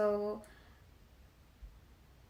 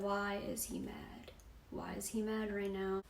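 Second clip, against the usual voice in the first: much softer, under the rant; baffled inner thought; 10 dB quieter than usual. Retold or imitated. Girlfriend sitting through his rant, quietly baffled. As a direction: think it aloud quietly, puzzled. Her silent thought while he rants.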